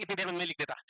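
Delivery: ordinary delivery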